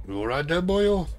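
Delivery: put-on high, swooping amateur Welsh accent; Welsh boyo accent. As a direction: have a go at a Welsh boyo, pitch climbing up. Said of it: "Best Welsh I can do".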